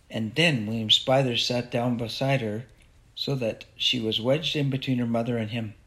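ordinary delivery, nothing marked

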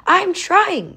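high-pitched, exasperated call back; restrained shout, pitched far up. A narrator's reading. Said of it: "Sage shouted back".

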